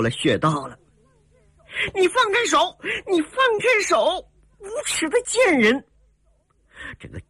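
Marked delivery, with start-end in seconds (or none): 2.0-5.8 very high-pitched, shrieky outrage; furious struggle. A narrator's reading. Heroine held by the pressure point curses captor, furious. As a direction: demand release, pitched way up in fury.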